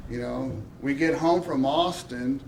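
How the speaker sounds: ordinary talk, nothing marked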